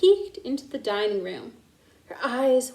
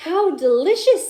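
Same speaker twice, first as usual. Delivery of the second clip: higher, louder praise; delighted; 5 semitones higher, 5 dB louder than usual. A narrator's reading. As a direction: savor the dish aloud. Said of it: "said Maggie".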